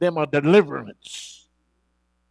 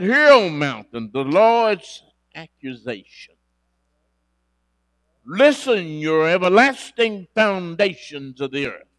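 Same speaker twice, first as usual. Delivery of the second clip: loud, ringing proclamation of God's charge; preaching at full force; 3 semitones higher, 7 dB louder than usual. Acted. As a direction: call out to the mountains like a prophet. Preacher voices the Lord's accusation.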